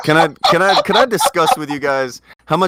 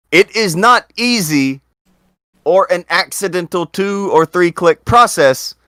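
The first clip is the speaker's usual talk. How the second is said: every word hit higher and slower; emphatic; 7 semitones higher, 3 dB louder than usual. Real, not acted. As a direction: stress "It is not easy" hard and slow. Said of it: "I need to emphasize this to you".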